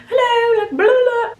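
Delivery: high, exaggerated mock British hello; a high-pitched fake accent parody. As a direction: squeal a silly imitation of her accent. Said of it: "That's not actually how she sounds".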